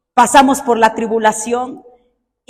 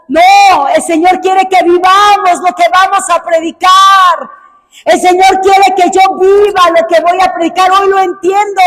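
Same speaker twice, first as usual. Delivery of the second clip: loud, high pulpit shout; fiery sermon climax; preaching at full force; 5 semitones higher, 4 dB louder than usual. Acted. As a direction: drive the point home to the congregation.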